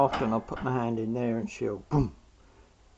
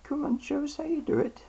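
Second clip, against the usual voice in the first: high coaxing pet talk. Real, not acted.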